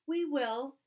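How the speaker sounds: loud, high eager promise. Acted. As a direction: promise it all at once, eagerly. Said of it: "said Tiny Chick's brothers and sisters".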